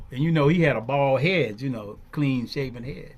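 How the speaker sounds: ordinary talk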